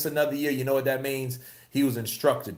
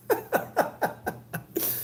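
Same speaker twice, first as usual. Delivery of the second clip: high breathy laugh; 4 dB quieter than usual. Real, not acted.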